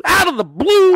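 loud, high hyped-up yell; shouting. Acted. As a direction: belt it out like a hype man.

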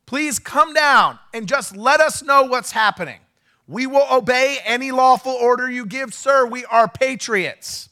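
high, loud and slow appeal to the police; retold bullhorn shout. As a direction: call it out slowly, pleading but firm. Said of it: "I said".

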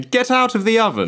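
high, bossy machine command; mock machine voice. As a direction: bark it like a machine.

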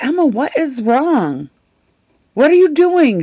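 high, disbelieving questions; incredulous. Retold or imitated. Streamer asking her confused friend, high and incredulous. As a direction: pitch it up in disbelief.

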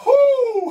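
a very high, loud laughing "whoo"; laughing whoop. Real, not acted. Preacher whooping with a laugh at the church. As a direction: burst out in a joyful whoop.

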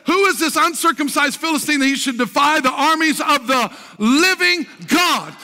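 high, fired-up sermon delivery; full-force preaching. Real, not acted. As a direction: preach it boldly at a high pitch to a roused congregation.

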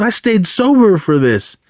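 high, aggrieved retold protest; mock indignation. Alcoholic student protests the failed driving test, mock indignant. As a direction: imitate someone put out, pitched high. Exasperated complaint in retold movie scene.

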